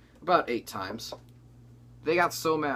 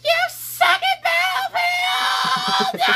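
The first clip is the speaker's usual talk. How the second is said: very high, drawn-out kiddie shriek; screaming five-year-old; 12 dB louder, much slower than usual. Retold or imitated. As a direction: shriek it slowly like an outraged little kid. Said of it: "my impression of a five-year-old screaming at me".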